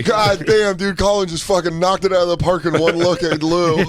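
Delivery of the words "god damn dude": ordinary talk, nothing marked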